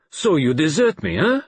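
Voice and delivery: loud, high mock reproach in character; Poirot exclaiming. A narrator's reading. Poirot chiding the friend who stayed away, exclaiming. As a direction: exclaim it accusingly, lifting on the tag question. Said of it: "exclaimed Poirot".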